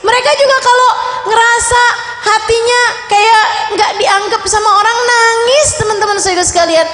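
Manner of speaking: impassioned sermon delivery; fervent preaching. Acted. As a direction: drive the point home like a rousing preacher. Preacher builds to fervent exhortation.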